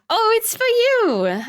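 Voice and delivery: high, slow delighted surprise; drawn-out happy surprise; surprised squeal. Real, not acted. Surprised at question.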